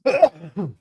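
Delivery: harsh real coughing; a sudden hacking cough; coughing fit. Real, not acted. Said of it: "I'm having a coughing fit".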